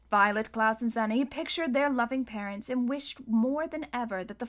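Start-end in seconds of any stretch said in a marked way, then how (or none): none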